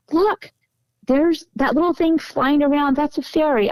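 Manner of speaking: high, excited imitation of a woman; excited pointing-out. Woman shows others a fairy on the phone, excited. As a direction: voice her delighted discovery, pitched well up.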